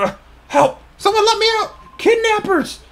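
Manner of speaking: loud, high-pitched panicked shouting; cry for help. Acted. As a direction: yell out for rescue in a high voice. Voices captive calling for help.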